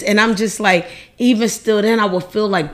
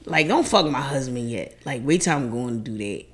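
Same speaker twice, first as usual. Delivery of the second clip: much softer, lower, fading pleas; weak dying voice; 10 semitones lower, 10 dB quieter than usual. Pretend. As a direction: beg faintly from the deathbed. Dying wife whispering pleas.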